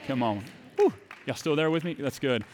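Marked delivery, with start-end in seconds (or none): none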